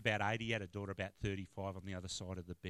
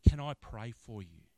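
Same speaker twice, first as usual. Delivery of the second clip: earnest offer; 2 semitones higher, 13 dB louder than usual. Retold or imitated. Retold offer to pray.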